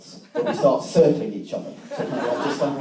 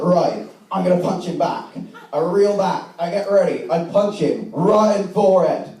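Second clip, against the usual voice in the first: high-pitched, exclaiming broad Yorkshire; Yorkshire accent; 3 semitones higher than usual. Acted. Comic retelling a playground fight as an epic, in worked-up Yorkshire. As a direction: rant in a put-on Yorkshire accent. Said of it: "do the lessons in Yorkshire now".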